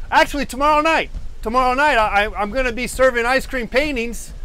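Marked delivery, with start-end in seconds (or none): none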